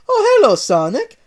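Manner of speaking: high, loud friendly Italian greeting; Mario greets his rival, friendly Italian; kindly Mario. Acted. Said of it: "Mario kindly greeted".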